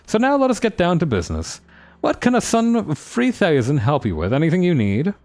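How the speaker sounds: high, grand merchant showman; fancy voice. Acted. Merchant prince thanks his rescuer, high and grand. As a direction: gush then get to business. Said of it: "a fancy voice".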